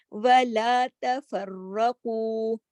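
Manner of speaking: تَفَرَّقُوا with a full, heavy ر; heavy ra recitation. Shown as a demonstration. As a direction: recite slowly, ra thick. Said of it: "را کو موٹا". (تَفَرَّقُوا میں را موٹا)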